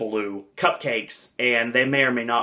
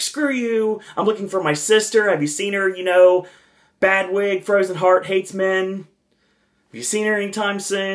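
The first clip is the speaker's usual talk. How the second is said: higher-pitched, indignant retort; lightly mocked feisty character voice; Anna; 7 semitones higher than usual. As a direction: imitate a brash cartoon princess. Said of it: "she turns around, she's like".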